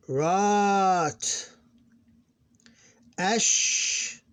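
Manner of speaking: long vowels held high and steady; syllable-by-syllable recitation. Shown as a demonstration. Teacher reading Arabic syllables aloud, measured recitation. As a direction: chant each syllable apart, stretching long vowels.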